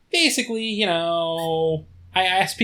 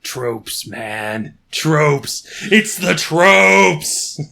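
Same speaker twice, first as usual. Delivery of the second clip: building shout; 8 dB louder, much slower than usual. Acted. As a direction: build to a loud dramatic outburst. Building dramatic proclamation.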